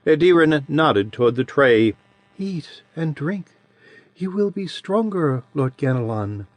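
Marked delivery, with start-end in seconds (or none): 2.4-6.5 a lightly voiced childlike creature, a touch higher and softer; soft childish voice of an ancient being; sweet childish voice. A narrator's reading. Adiran's voice held.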